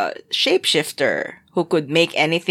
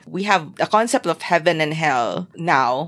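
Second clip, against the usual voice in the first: usual speaking voice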